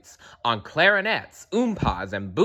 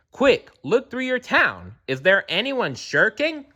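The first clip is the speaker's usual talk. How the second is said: higher, pressing and anxious; urgent raised voice; 8 semitones higher than usual. Acted. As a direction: hurry them along, a touch frantic. Kangaroo/Horton urging the mayor.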